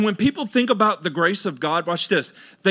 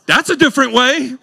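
much higher and louder through a laugh; 8 semitones higher, 7 dB louder than usual. Real, not acted. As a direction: laugh out the punchline. Laughing punchline.

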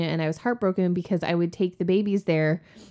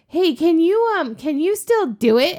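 high, louder imitation of keen coworkers; eager mock pleading; 12 semitones higher, 7 dB louder than usual. Coworkers asking to hear the accent, imitated eagerly. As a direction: pounce on the request with mock eagerness.

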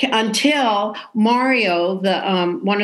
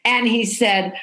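usual speaking voice

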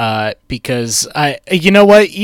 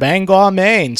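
higher-pitched mock Maine accent; 6 semitones higher, slower than usual. Host echoing co-host's town name, mock Maine accent. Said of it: "the Maine accent touching our hearts".

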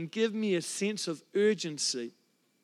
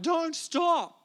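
higher, pitched-up cry, half-held back; mock yell; 9 semitones higher, 3 dB louder than usual. Pastor retelling the urge during worship, restrained mock yell. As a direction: call it out like you can barely hold it in. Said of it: "just yell out".

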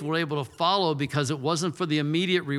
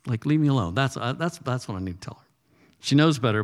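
usual speaking voice

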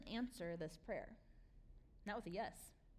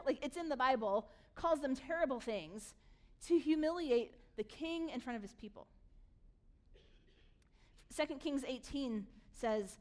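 ordinary talk, nothing marked

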